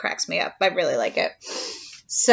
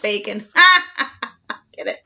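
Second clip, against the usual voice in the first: usual speaking voice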